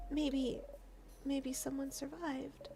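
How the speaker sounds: very soft, slow, uncertain hope; quiet hopeful. Acted. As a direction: murmur it barely daring to hope. Worried hope for survivors.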